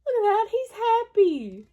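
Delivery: plain reading voice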